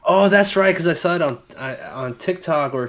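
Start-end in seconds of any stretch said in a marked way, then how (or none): none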